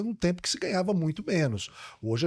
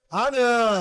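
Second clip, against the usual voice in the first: excited; 9 semitones higher, 6 dB louder, slower than usual. Real, not acted. Delighted at the food.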